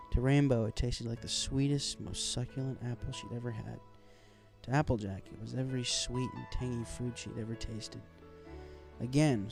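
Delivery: plain reading voice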